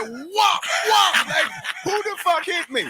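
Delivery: high, loud startled whoas; mock-dazed yell after a hit; stunned by a punch. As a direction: reel back in shock. Retold getting hit in fight.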